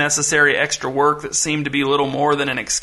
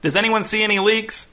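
raised pitch, mild call from above; 6 semitones higher than usual. A narrator's reading. Boss on deck asks the crew below, calling down. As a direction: call a question down to the workers. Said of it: "shouted Pottle from up on the deck".

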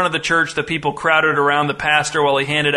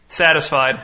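usual speaking voice

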